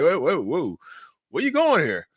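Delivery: high, put-on startled voice; reacting to his partner's insult, mock alarm; mock alarmed whoa. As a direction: pull up short, acting stunned. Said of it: "I'm like".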